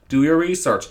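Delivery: ordinary talk, nothing marked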